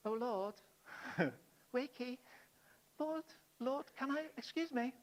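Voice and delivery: soft, timid mock wake-up call; mock gentle whisper. Disciples politely waking Jesus, mock hushed timid voice. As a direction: tiptoe the request, comically polite. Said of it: "I can't imagine it was with a gentle little".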